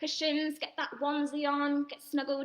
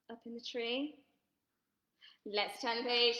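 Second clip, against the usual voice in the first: ordinary delivery, nothing marked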